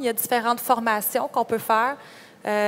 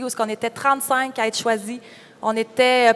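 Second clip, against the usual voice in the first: ordinary talk, nothing marked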